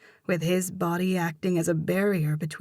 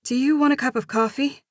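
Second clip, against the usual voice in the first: higher, quick nervous question; blurted invitation; 8 semitones higher, 4 dB louder, faster than usual. A narrator's reading.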